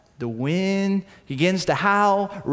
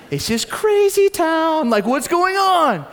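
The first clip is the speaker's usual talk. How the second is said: much higher, louder, slow alarm; alarmed disbelief; 11 semitones higher, 5 dB louder, slower than usual. Retold or imitated. Disciples reacting to the naked man running around, alarmed. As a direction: react like you can't believe this place.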